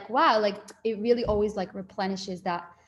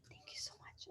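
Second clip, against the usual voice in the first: whisper; 24 dB quieter than usual. Retold or imitated. Grateful whisper in the car.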